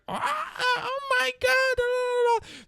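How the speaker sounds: very high, drawn-out mock shriek; wailing falsetto imitation of horrified screamers; mock screaming. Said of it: "you hear them screaming".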